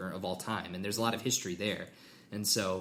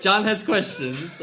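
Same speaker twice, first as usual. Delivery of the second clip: mock announcer; 8 semitones higher, 8 dB louder, slower than usual.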